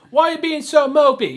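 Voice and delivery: high, loud needling question; mock berating. Tactless kid berating crying strangers, loud mock needling. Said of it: "just beating and berating these people".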